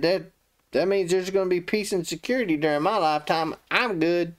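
high, lightly mocked complacency; self-satisfied. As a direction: imitate a king pleased for himself. Acts Hezekiah selfishly pleased with prophecy.

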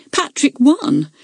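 high, crowing announcement; triumphant cry. A narrator's reading. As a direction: announce the victory in triumph, pitch lifted. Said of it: "cried Arabella triumphantly".

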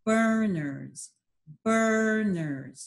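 "Burners" stretched so both beats stand out; syllable by syllable. Shown as a demonstration. Teacher to child, sounding out syllables slowly. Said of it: "How many times did my chin drop?".

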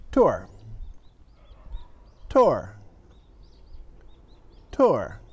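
tour said the native English way; English word dictation. Shown as a demonstration. Teacher reads tour for students to write. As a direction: read tour clearly for dictation. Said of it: "say a word with my accent".

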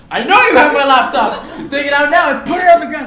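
very high, loud yelled demand breaking into laughter; laughing at his own yelling; laughing shout. Retold or imitated. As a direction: yell after him across the street. Chasing thief, yelling again.

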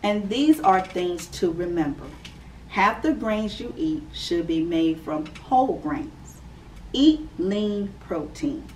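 clear, measured read-aloud voice; gentle storybook reading for kids; reading to children. Acted. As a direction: read it out like story time for little ones. Reads picture book to children.

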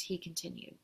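ordinary delivery

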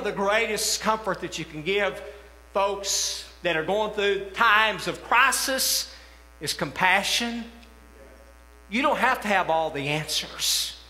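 ordinary talk, nothing marked

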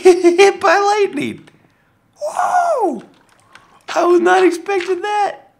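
real high, loud shocked yelps; startled shrieks. Real, not acted. Vlogger struck by lightning mid-build, startled shrieking. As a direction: shriek in genuine fright and laughter. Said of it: "That gave me a genuine heart attack".

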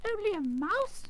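very high, offended little squeak; indignant squeaky mouse. A narrator's reading. Mouse queen offended by the Tin Man, indignant squeak. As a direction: squeak it back as an affronted question. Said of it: "cried the little animal indignantly".

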